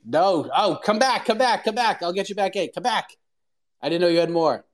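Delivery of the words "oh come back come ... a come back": higher-pitched, urgent pleading to return; calling back. Real, not acted. Host cut off his co-host too soon, calling him back high. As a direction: call them back in a quick, high voice.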